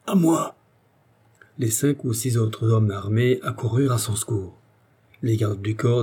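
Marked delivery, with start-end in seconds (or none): 0.1-0.5 high cry for rescue; understated roar, calling out; roaring for help. A narrator's reading. Trampled man calls comrades to his rescue, crying out. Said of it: "poussa un rugissement criant".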